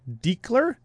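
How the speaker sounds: "Diekler" with a long EE and hard K, rising like a question; guessed pronunciation. Shown as a demonstration. Host guessing at a patron's surname, questioning "Diekler" as DEEK-ler. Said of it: "I don't know".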